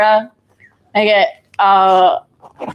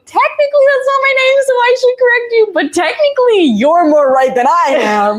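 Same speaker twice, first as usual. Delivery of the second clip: much higher, flustered inner thought; 14 semitones higher than usual. Retold or imitated. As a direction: squeak it out, caught off guard. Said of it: "'cause I'm like".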